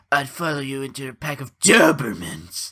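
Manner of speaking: weird put-on cartoon voice, punching DOBERMANS; Nick Cage. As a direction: draw out and swoop on Dobermans in an odd voice. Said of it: "he's putting on this weird voice".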